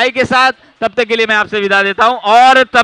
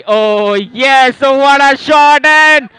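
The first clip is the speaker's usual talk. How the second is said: excited cricket commentary; 7 semitones higher, 7 dB louder than usual. Real, not acted. Commentator calls the title win.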